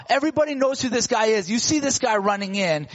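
ordinary talk, nothing marked

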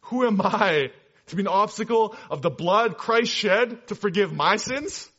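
slow, loud, high-pitched declaiming; imitated fatherly voice. Father speaks of grace to his missionary son, imitated impassioned fatherly voice. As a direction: proclaim it as an impassioned father, word by word. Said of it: "in his deep fatherly voice".

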